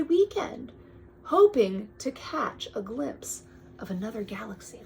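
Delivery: storybook read-aloud, drifting to a hush; bedtime-story reading; reading to a child. Acted. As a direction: read the book to your kid. Parent reads picture book aloud.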